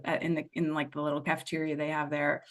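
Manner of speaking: ordinary delivery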